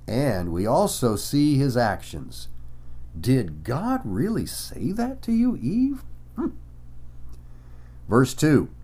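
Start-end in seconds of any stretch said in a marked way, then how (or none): 3.2-6.5 high, rising, needling question; insinuating doubt. Acted. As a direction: voice the serpent sowing doubt, pitch swooping up. Voicing the serpent tempting Eve.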